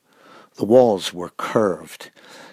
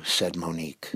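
plain reading voice

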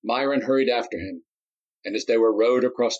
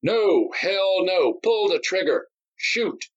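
crowd shouting; 10 semitones higher, slower than usual. Acted. Enraged spectators shout at Darius to shoot. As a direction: yell for the kill. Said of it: "they shouted back".

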